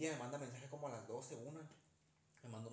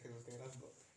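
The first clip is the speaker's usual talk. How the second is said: softer, quicker hushed mock-spooky version; podcast ghost line; 3 semitones lower, 7 dB quieter than usual. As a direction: murmur it like a creepy podcast clip. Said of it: "Así lo pone el podcast".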